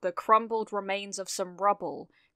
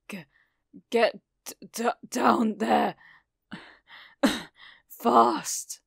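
halting, strained words broken by coughs; struggling, coughing; 2 semitones higher, 4 dB louder, slower than usual. A narrator's reading. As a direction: force out each word through injury and ragged breath. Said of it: "he struggled, coughing again".

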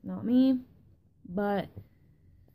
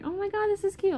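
higher gushing oh my god; excited quote; 11 semitones higher than usual. Retold or imitated. Retelling her excitement over the Lunchable, higher gushing voice. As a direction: gush over the cute package. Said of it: "I was so excited".

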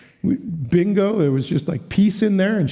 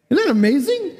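marvelling; 10 semitones higher than usual. Real, not acted. Preacher marvels at scripture.

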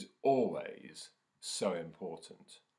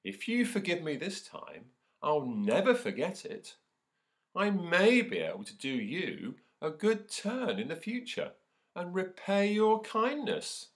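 higher, louder pleading mouse; a little mouse pleading, pitched up; squeaky mouse; 12 semitones higher, 7 dB louder than usual. Acted. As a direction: squeak out the promise eagerly. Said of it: "it squeaked".

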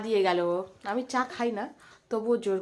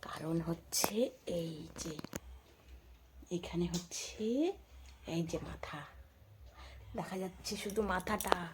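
whispering; 6 dB quieter than usual. Real, not acted. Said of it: "ওই কারণে এত আস্তে আস্তে কথা বলছি".

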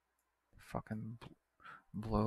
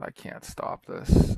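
strained, breathy cry of frustration, much louder and slow; frustrated outburst; 5 semitones lower, 12 dB louder, slower than usual. Real, not acted. Gamer losing his city to the AI, strained loud exasperation. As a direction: blurt it out, helpless and exasperated.

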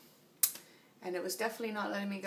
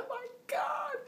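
high-pitched imitation of a panicking friend; mock panicked cry; 3 dB louder than usual. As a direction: cry out in exaggerated alarm, far above your own voice. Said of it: "he thought that something really serious had happened".

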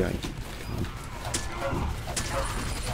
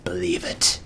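normal talking voice

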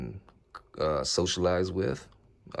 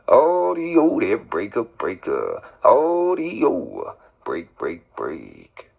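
calling breaker on the channel; loud radio break call; 7 dB louder, much slower than usual. Real, not acted.

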